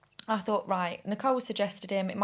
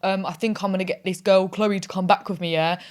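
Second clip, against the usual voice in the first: loud mock phone call to a mate; imitating her date; 6 dB louder than usual. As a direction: act out his excuse to a mate. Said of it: "This is how he spoke".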